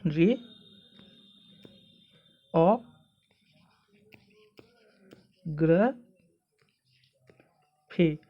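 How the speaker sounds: जी, ओ, ग्र, फी as separate sounds with long pauses; teacher isolating each piece: जी, ओ, ग्र, फी; syllable by syllable. Shown as a demonstration. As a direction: sound out जी ... ओ ... ग्र ... फी one at a time. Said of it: "इसका sound कुछ इस प्रकार आता है".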